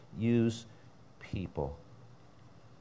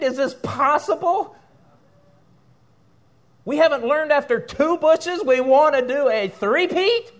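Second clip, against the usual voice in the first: much higher and louder, laughing in disbelief; real excited outburst, pitch flying up; 12 dB louder than usual. Real, not acted. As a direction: erupt into a high incredulous rant. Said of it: "I get a little excited".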